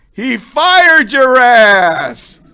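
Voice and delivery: hammed-up yell at the punchline; big shout. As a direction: bellow it out slowly.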